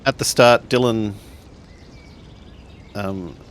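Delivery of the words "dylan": ordinary talk, nothing marked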